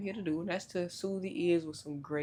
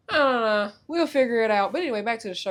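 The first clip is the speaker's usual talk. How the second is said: louder; 6 semitones higher, 11 dB louder than usual. Real, not acted. Snapping back to the intro.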